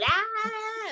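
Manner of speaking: put-on very high, far-off scream of a crowd of girls; a squealing scream from fans; imitated girls' scream. Retelling how the audience of girls screamed, a very high squeal. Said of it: "the girls all started screaming".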